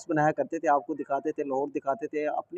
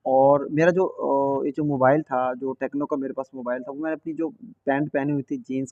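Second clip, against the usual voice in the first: usual speaking voice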